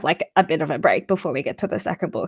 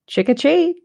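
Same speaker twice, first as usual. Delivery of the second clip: higher-pitched, rising tune, oddly grown-up; lightly voiced adult's trick or treat; strangely adult trick-or-treat; 7 semitones higher, 3 dB louder than usual. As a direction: say it oddly, lifting at the end. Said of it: "I said that really strangely".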